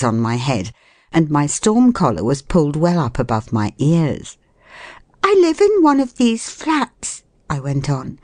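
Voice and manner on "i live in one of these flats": very high woman's character voice; flustered lady. A narrator's reading. Lost woman to the flat's owner, flustered. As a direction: explain yourself in a high, anxious voice.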